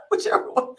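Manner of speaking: breathy giggle at herself; high airy chuckle; embarrassed laugh. Real, not acted. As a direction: laugh off the blank moment. Laughs at forgetting shape name.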